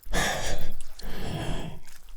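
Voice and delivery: a heavy breathy creature exhale; long loud creature sigh. Acted. Spider mother sighing at the interrogator's face, long loud sigh. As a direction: sigh out loud, weary and inhuman.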